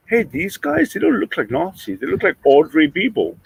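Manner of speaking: high-pitched voice of a puzzled onlooker; mimicked public reaction; imagined onlookers. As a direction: lift the pitch as if just noticing. Voices surprised ordinary onlookers.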